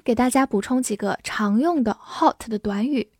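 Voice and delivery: usual speaking voice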